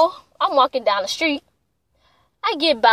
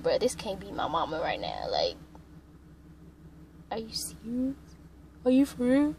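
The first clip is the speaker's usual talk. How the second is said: hushed, near-whispered disbelief; very soft, incredulous aside; stunned inner thought; 2 semitones lower, 12 dB quieter than usual. Retold or imitated. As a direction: think it aloud under your breath. Retold inner thought, stunned at mother.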